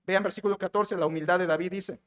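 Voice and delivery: ordinary talk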